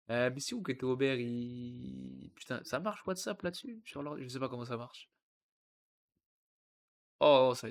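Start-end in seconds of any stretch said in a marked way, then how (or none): none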